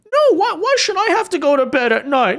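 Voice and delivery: high, whiny mock-child voice with a laugh; mock a toddler whining about bedtime. Commentator mocking liberals as toddlers, whiny child voice. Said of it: "the mindset of toddlers".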